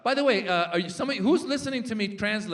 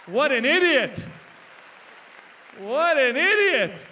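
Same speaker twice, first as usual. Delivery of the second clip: loud, high cry of disbelief; exasperated exclamation; 7 semitones higher, 8 dB louder than usual. Acted. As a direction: belt it out, aghast at such stupidity. Exclaiming over Trump's foolishness.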